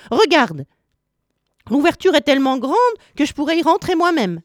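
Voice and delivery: high, insistent explaining; exasperated witch. A narrator's reading. Witch proving to Gretel that the oven is roomy, exasperated. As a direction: show her impatiently in a high voice.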